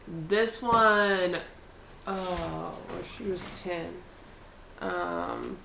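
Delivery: normal talking voice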